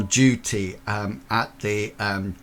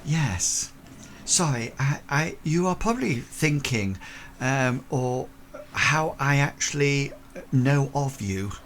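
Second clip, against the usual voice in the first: higher, hesitant lady's voice; apologetic Victorian lady; 4 semitones higher than usual. Acted. Lady explaining herself to a stranger, higher and hesitant. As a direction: own up delicately as a polite lady. Game master plays Miss Lawrence.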